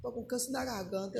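low, very soft voice; hushed confession. Retold or imitated. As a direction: imitate a pastor quietly breaking bad news. Retells pastor revealing his cancer.

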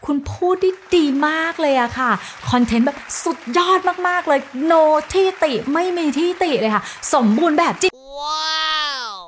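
high, gushing, exaggerated compliments; mock someone flattering way too much; over-the-top praise. Overdone feedback giver gushing at a speaker, high mock praise. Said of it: "feedback แบบ เวอร์วังเกินไปอะ เช่นแบบ".

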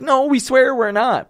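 high, drawn-out mock plea; pleading protest. Germans answering whether they're stupid, mock pleading. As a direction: protest innocence in an exaggerated high voice.

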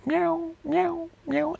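maullido agudísimo y suave de gatito; maullido de gato. Pretend. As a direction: imita a un gato que maúlla bajito y agudo. Bart has a cat. (miau agudo y suave de gato)

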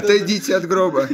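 high voice, laughing through it; acted doctor. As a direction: play the doctor, giggling. Acting the doctor's line.

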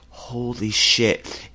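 awestruck. Retold or imitated. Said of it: "And he was like".

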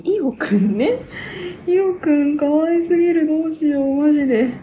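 high, loud, squealing fan gush; excited gushing. Real, not acted. A fan gushing to viewers about Io, high and loud.

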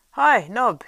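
loud, high bellow; shouting for the servant. A narrator's reading. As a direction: yell across the inn. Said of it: "he shouted".

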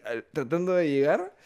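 ordinary delivery

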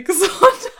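Gesundheit durchs Lachen; laut und lachend. Real, not acted. (lachend sagen)

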